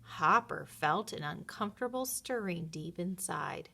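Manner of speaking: usual speaking voice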